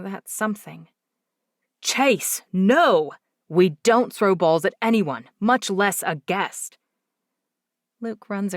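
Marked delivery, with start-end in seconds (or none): 1.8-6.8 loud firm father telling off; sharp reprimand to his boy; stern scolding. A narrator's reading. Father scolds son for throwing.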